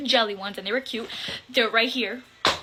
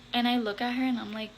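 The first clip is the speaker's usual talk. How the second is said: ordinary talk, nothing marked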